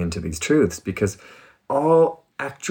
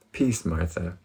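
gentle peace; 5 semitones lower, 5 dB quieter, slower than usual. Retold or imitated. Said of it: "Jesus says".

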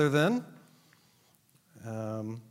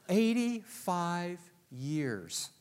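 each word drawn out, half speed; slow emphatic repeat; 4 semitones higher, much slower than usual. Real, not acted. Preacher repeating a figure to the congregation, slow and emphatic. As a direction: stretch it out and let every word land.

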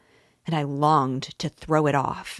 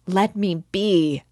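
higher, louder, slow pained plea; agonized cry; anguished plea; 4 semitones higher, 5 dB louder, slower than usual. A narrator's reading. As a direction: beg to be left alone, hurting. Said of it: "in a sudden spasm of agony".

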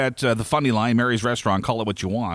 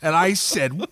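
ordinary talk

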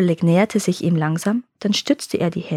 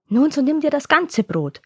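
slightly annoyed; 7 semitones higher than usual. Acted. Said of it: "sagte die Mutter etwas ärgerlich".

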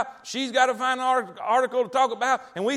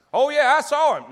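loud, higher-pitched claim, put on; mock boast; 4 semitones higher, 9 dB louder than usual. Mocking someone who claims he saw God, loud mock boast. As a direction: pipe up loudly with a put-on claim.

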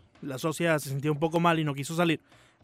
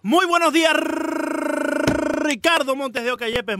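exuberant greeting; 9 semitones higher than usual. Real, not acted. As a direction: belt out the name like a ring announcer. Saluto enfatico all'ospite in radio.

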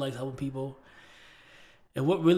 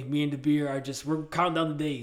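normal talking voice

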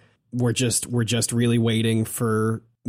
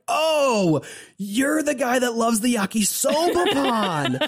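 high mock fangirl recognition; hammed-up high excited fans; starstruck crowd imitation; 11 semitones higher, slower than usual. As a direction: gush like you spotted a celebrity. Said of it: "everybody's like".

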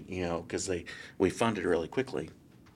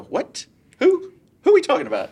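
much higher and louder, squeaking in disbelief; can't believe what you're hearing; stunned disbelief; 9 dB louder than usual. Retold or imitated. Agent hears the loan is approved, shocked and much higher.

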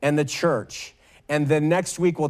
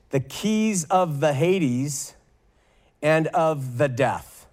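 slow emphatic reading; slower than usual. Acted. Slow emphatic scripture quote.

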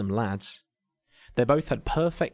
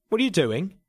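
alarmed question; 8 semitones higher, 3 dB louder than usual. A narrator's reading. Alarmed at neck kissing.

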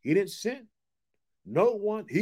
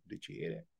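normal talking voice

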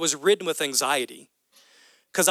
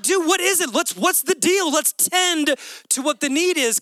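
higher and urgent, leaning in; urgent concerned parent; 7 semitones higher than usual. Retold or imitated. Father to child who skinned a knee, higher and urgent. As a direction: rush to help the hurt kid.

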